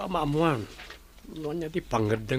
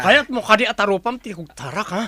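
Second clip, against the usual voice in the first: loud, menacing demand; angry threat; 3 semitones higher, 8 dB louder than usual. Acted. Master threatening the servant, loud and angry. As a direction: threaten him, voice getting louder.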